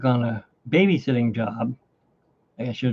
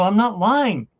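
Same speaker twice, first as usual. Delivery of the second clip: excited insistence; 8 semitones higher, slower than usual. Retold or imitated. Insisting to friend on phone.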